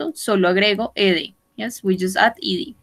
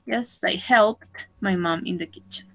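whispered model sentence; 3 semitones lower, 6 dB quieter than usual. Shown as a demonstration. Teacher whispering the model sentence to the class. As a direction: breathe the whole sentence softly.

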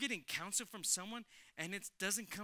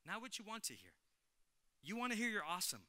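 ordinary talk

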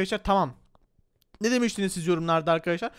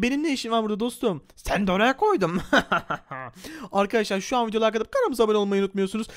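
usual speaking voice

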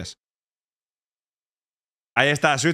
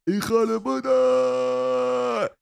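higher, drawn-out slow shouted curse; a higher, slow comic yell; mock outburst; 7 semitones higher, much slower than usual. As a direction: hurl it out long and hammed up.

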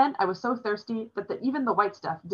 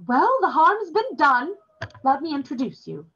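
higher, louder, slow resigned hostess; resigned old demoness; 8 semitones higher, 5 dB louder than usual. A narrator's reading. Demoness giving up on the scolding to make introductions, resigned. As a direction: make the best of it.

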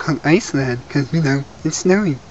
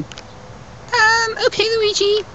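high squeaky voice; 3 dB quieter, much slower than usual. Acted. Squeaky voice returns.

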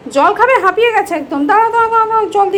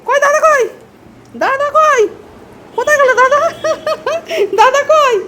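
playful high-pitched calling to the pet; sing-song pet talk; 10 semitones higher, 4 dB louder than usual. Real, not acted. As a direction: coo at the pet, asking where brother went. High pet talk asking for brother.

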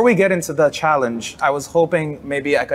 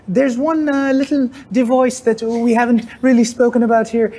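higher-pitched put-on Irish lilt; Irish accent; 5 semitones higher than usual. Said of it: "it's my Irish accent".

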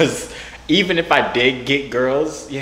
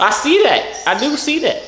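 high-pitched, pointed retort; mock spousal huff; 13 semitones higher, 4 dB louder than usual. Bickering with a pretend ex, high and huffy.

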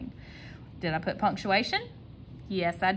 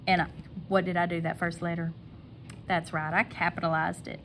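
ordinary talk

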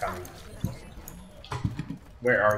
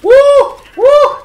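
short, high, loud whoops; 11 dB louder than usual. Real, not acted. Lost player finding the fighting, high whoops. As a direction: whoop like a siren going off.

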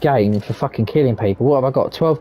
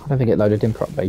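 ordinary talk

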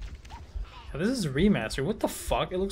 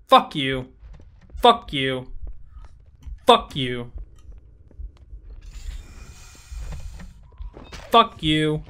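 loud, punchy repeated curse; angry cursing; 4 dB louder, much slower than usual. Real, not acted. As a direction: spit it out each time, louder.

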